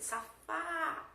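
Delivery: Safá with a high, long open FÁ; stressed syllable drawn out. Shown as a demonstration. Teacher showing an open timbre, stressed á held. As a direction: stretch the final á of Safá high. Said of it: "que é o fá".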